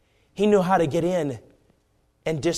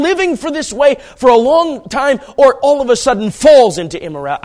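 loud, raised-pitch sermon delivery; impassioned preaching; 8 semitones higher, 9 dB louder than usual. Acted. Preacher warning the congregation about immorality, impassioned. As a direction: preach it hard, building to loud peaks.

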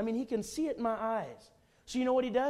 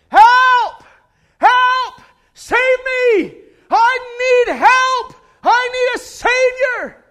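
very high, full-force desperate scream; calling out for help; 17 dB louder, much slower than usual. Acted. Preacher as a man trapped in a pit, calling out for help. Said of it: "a man in a pit who is calling".

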